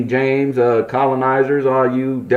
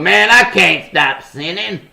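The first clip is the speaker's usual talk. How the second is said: higher mock whiny churchgoer voice; 6 semitones higher than usual. Mocking Christians who say they can't stop sinning, in a higher whiny voice. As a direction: imitate a defeated believer, whining. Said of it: "a lot of Christians gonna be like".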